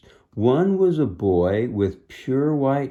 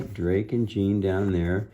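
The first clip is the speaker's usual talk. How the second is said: usual speaking voice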